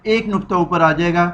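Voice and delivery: ordinary talk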